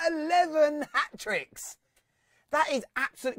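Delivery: high, laughing through eleven hat tricks; laughing in disbelief. Real, not acted. Host reading stats to fans, laughing in amazement.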